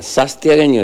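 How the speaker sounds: "Sastiagueño" with an added S after the first syllable, no N; mock Santiago accent, "Sastiagueño" with an extra S; extra S mispronunciation. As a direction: say "Sastiagueño", swapping the N for a hissed S. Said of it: "le sacaba una N para agregarle una S más".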